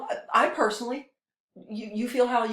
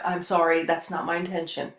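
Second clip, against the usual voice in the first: ordinary delivery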